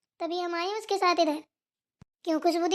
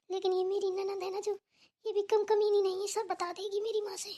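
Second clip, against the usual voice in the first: panicked whisper to self; 8 dB quieter than usual. Acted. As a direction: whisper your panic so no one hears. Guest still whispering in fear. (पकड़ी गई मेहमान घबराकर खुद से फुसफुसाती है)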